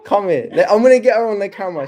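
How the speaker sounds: loud, high and hurried; excited calling out. Real, not acted. Streamer gets mum onto the camera, loud excited call. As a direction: call out eagerly across the room.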